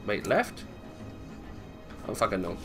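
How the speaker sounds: normal talking voice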